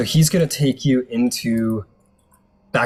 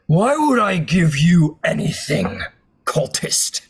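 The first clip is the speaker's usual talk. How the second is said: higher, sneering giant voice; cloud giant; 5 semitones higher than usual. Acted. Cloud giant sneering at the suspected cultist, higher. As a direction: scorn the cultist, a touch high.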